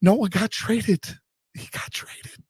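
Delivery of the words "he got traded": breathy unvoiced whisper. Real, not acted. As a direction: whisper it in disbelief. Stunned after announcing trade.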